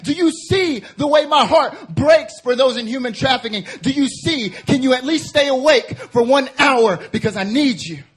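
loud, high, pleading cry as Jesus; full-force preaching. Acted. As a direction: build the pleading cry line after line.

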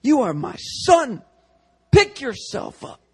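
slow, high fervent proclamation; slow fervent shout of adoption; excited preacher imitation. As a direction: raise him up, slow and high. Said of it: "He got so excited".

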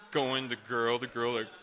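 usual speaking voice

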